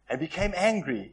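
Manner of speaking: ordinary talk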